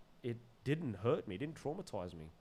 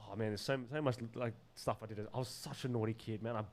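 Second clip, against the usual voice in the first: plain reading voice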